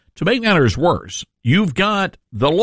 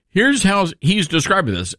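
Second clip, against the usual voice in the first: ordinary talk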